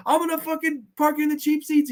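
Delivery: high comic menace; threatening Bobcat voice. Retold or imitated. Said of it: "he's threatening the fucking tumor".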